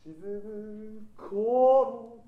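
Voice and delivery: 沈む頃 very slow with the accent on 頃; slow 沈む頃 with a stressed 頃. Shown as a demonstration. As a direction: lean into 頃 of 沈む頃. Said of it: "この頃についてるアクセントも".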